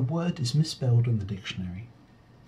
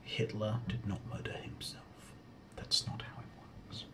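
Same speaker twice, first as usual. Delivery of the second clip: whispering; 2 semitones lower, 6 dB quieter than usual. Real, not acted. Aside on the question.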